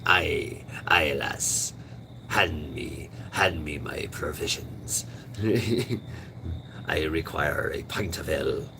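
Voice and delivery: hammed-up pirate, partly whispered; mock pirate voice. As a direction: play a silly pirate asking for ale. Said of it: "start talking like that".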